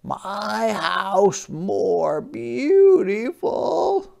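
high, slow, gentle crab voice; a small polite sea creature asking; Hermit Crab voice. Acted. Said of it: "said Hermit Crab".

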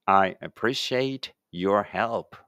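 slow, carefully spaced English for the class to repeat; slow model reading. Shown as a demonstration. Teacher models English for pupils reading aloud together, slow and clear. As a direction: say each word slowly and clearly, like a teacher modeling. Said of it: "一緒に声出してください".

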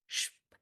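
স্প as bare "shp", no vowel; sound demo. Shown as a demonstration. Teacher demonstrates the cluster, স্প as isolated "shp". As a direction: hush "sh" closed by a popped "p" for স্প. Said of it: "এরকম".

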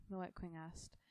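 plain reading voice

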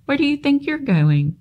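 high, frantic call after the spider; panicked pig, pitched well up; frantic cry. Acted. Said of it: "Wilbur screamed".

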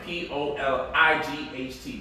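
letter by letter, slow; spelled out slowly. Shown as a demonstration. Host spelling the app name for viewers, slow. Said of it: "the correct way to spell it".

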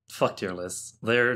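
ordinary talk

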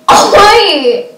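loud high indignant oi; exasperated poked game character. Real, not acted. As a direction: yell at them to stop poking. Poked during the recording.

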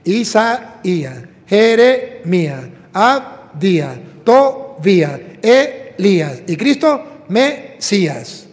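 Isaías, Jeremías, Mesías spoken slowly and loudly, with the ía ending stressed; slow, heavy names that land on ía. Shown as a demonstration. Preacher to congregation, giving each name slowly with the ía stressed. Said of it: "al final I A".

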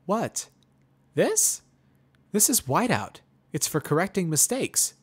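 put-on high, loud, puzzled young mare. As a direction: lift way up and sound baffled. Pony voice, puzzled.

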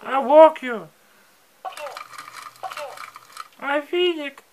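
parrot voice. Acted. Owner coaching parrot to talk.

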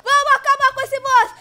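high, loud battle cry; sudden loud, high holler; rallying shout. Real, not acted. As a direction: fire up the team with a yell. Rallying for the boss fight.

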